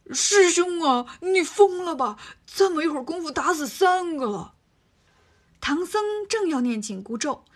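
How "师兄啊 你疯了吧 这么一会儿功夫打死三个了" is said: egging on. Acted. Bajie to his master about Monkey, high and loud mock alarm. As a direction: stir the pot, sound scandalised. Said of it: "添油加醋地说".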